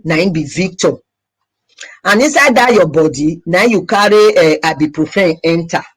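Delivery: speaking Nigerian Pidgin English. Real, not acted. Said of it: "I have to say it in pidgin English".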